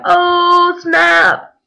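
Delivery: high, loud imitated "burn" reaction; crowd jeer. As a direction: whoop it like a crowd impressed by an insult.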